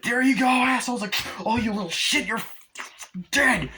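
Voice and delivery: high, loud furious yelling; mock angry yell. Victims yelling threats at the pranksters, high and loud. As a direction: scream threats at the kids.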